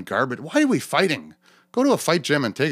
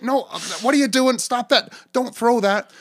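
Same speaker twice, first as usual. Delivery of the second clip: high, pleading teacher trying to calm a rowdy room; a higher-pitched flustered teacher voice; frazzled supply teacher; 11 semitones higher than usual. Retold or imitated. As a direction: plead with the crowd like a supply teacher losing the class. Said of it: "more of a supply school teacher than a comedian".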